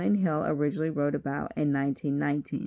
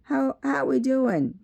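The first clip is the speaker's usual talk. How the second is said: slow, higher Southern US drawl; 9 semitones higher, 4 dB louder than usual. A narrator's reading. Green greeting Teddy on the phone in a Southern US drawl. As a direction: drag the vowels in a Southern US drawl. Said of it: "in his southern drawl".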